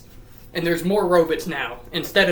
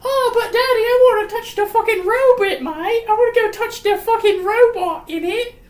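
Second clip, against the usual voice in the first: very high, loud mock little-girl pleading; Elizabeth; 6 dB louder than usual. Daughter begging Daddy to touch the robot, high hammed-up whine. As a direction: whine in a squeaky put-on child voice. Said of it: "And then Elizabeth's like".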